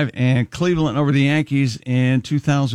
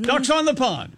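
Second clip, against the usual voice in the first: mock rally cheer; 9 semitones higher than usual. Mariners bench players rally while losing.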